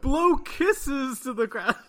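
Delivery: loud, slow, through laughter; laughing. Real, not acted. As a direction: say it while cracking up. Laughing quote.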